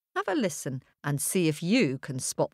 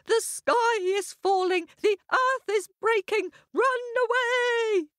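panicked shout. A narrator's reading. Hare shouting the warning to another hare, high and frantic. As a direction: cry the warning in terror. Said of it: "all shouting".

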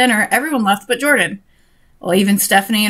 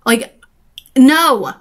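louder, higher "no"; exasperated refusal; 3 semitones higher, 5 dB louder than usual. Real, not acted. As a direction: reject it firmly, with a raised voice. Reader rejects the kiss scene.

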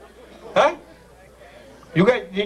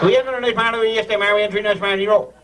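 very fast, words tumbling into each other; quick-fire demo, "marn" in one syllable; fast tongue-twister; faster than usual. Shown as a demonstration. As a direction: race through it in Newfoundland dialect. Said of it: "you gotta say fast".

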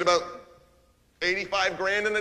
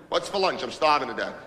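usual speaking voice

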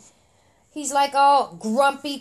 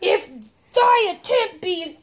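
heroic frog voice; 4 semitones higher than usual. Acted. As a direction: boom out an old-fashioned warrior's challenge. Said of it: "And the frog says".

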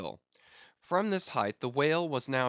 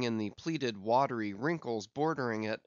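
ordinary delivery, nothing marked

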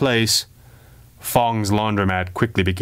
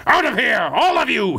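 roar; 11 semitones higher than usual. Acted. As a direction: roar an order no one defies. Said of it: "he roared".

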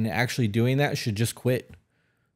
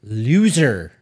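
punchy, louder blurt; blurted answer; 7 dB louder than usual. Acted. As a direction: throw the word out fast and loud. Word game, blurting answer.

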